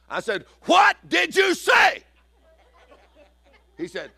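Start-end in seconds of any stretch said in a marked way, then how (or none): 0.6-2.0 high, slow, loud disbelieving yell; exasperated shout. Retold or imitated. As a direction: shout the question in total disbelief. Third time, exasperated.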